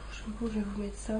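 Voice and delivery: très bas, presque pour soi; réfléchir tout bas en cherchant; marmonné pour soi. Real, not acted. Se parle en cherchant où swatcher. (à voix basse, hésitant)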